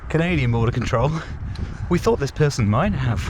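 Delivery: a light Canadian TV narrator; Canadian accent. Pretend. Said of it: "terrible Canadian accent".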